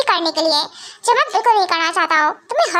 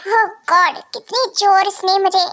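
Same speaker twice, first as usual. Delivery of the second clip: ordinary talk, nothing marked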